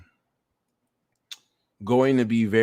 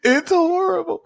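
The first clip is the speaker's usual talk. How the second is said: much higher, louder whimpering fake sob; hammed-up tearful whine; high, melodramatic fake blubbering; mock crying; 4 dB louder than usual. Mock-sobbing over wasting time.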